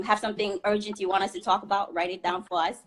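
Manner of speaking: ordinary talk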